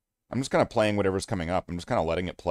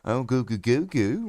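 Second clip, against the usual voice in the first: put-on baby talk. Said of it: "you're just kinda like".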